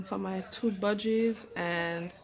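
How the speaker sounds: usual speaking voice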